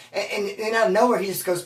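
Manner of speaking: ordinary talk, nothing marked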